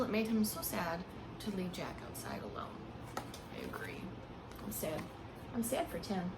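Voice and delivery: ordinary delivery, nothing marked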